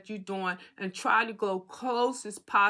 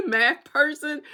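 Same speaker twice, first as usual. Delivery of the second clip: higher, louder, laughing through the words; 7 semitones higher, 8 dB louder than usual. Real, not acted. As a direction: laugh at your own slip, drawn out. Laughs at own math slip.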